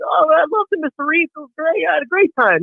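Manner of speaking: put-on high, eager little boy voice; a boy gushing to his baseball hero; kid voice. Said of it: "He goes".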